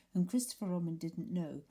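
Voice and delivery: plain reading voice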